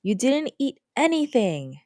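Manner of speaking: mother scolding daughter over the phone; accusing mom. Acted. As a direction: catch her child out. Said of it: "my mom will call me on the phone and say".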